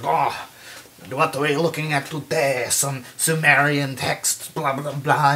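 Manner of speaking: mock French archaeologist voice; French accent. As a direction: put on a goofy French accent. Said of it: "this French archaeologist dude".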